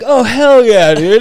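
thrilled someone recognizes you; excited. Retold or imitated. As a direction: snowboarder hearing a cop is a fan, loud excited cheer. Excited reply to cop fan.

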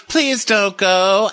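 high, very slow pleading; mocking Rinna begging Susan to stay; mock plea. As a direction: drag it out like a soap actress. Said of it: "and Rinna goes".

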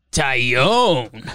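Taillon stretched into a falling howl; mock-crazed drawl of the name; drawn-out name call. Host reprises his crazed video of the pitcher's name, drawn out.

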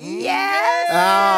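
loud cheer; cheering shout. Real, not acted. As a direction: yell it out in delight. Co-host cheering.

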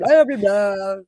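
loud, high mock chatter; mocking Mark rambling. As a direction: lampoon a host droning on to himself. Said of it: "Mark talk to himself ... be like".

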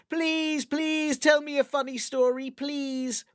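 put-on high, begging little girl voice; pleading little sister. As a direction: beg sweetly like a small child. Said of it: "his little sister would say".

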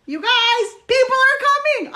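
high, loud excited announcement; thrilled that guests are arriving. Real, not acted.

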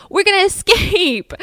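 high, laughing mock-heroic cry; gung-ho optimist. Optimist announcing the new plan, high mock-excited voice.

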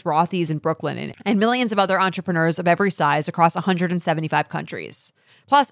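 upbeat podcast ad read. Acted. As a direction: read it like a sponsor spot. Recorded ad read.

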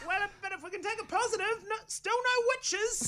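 very high, meek little voice; squeaky timid voice. Retold or imitated. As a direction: pipe up timidly from the back. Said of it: "someone in the back goes".

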